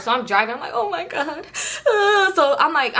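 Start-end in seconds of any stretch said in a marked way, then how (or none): 0.7-1.5 high, whimpering oh-my-God; soft high-pitched fretting; mock panic. Panicking at the wheel while followed, mock panic.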